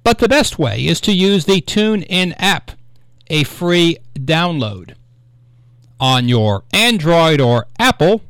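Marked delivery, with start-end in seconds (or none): none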